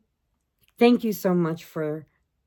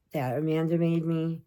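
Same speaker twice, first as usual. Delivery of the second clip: ordinary delivery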